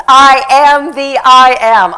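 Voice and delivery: slow, loud declaration; loud, slow preacher's proclamation; proclaiming. Real, not acted. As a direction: thunder it from the pulpit. Proclaiming scripture.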